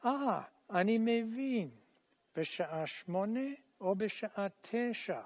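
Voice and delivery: teacher dialogue model; full model line. Shown as a demonstration. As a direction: say the whole line for them to echo. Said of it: "I'll do the whole line".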